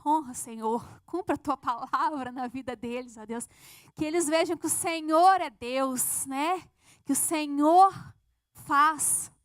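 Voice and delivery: prayer building to a loud cry; fervent prayer. Retold or imitated. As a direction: pray harder and harder, rising in volume.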